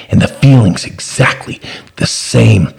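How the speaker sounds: usual speaking voice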